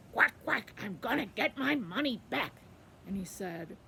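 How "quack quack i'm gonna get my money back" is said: loud, bouncy quacking voice; loud duck. Acted. Quackling telling the river his errand, loud duck voice. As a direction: punch each word like a quack.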